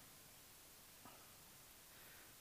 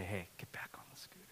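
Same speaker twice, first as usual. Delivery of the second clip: whisper; 11 semitones lower, 22 dB quieter, faster than usual. Retold or imitated. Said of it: "and then I, I say".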